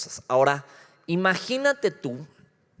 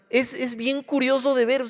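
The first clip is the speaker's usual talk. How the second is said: ordinary talk, nothing marked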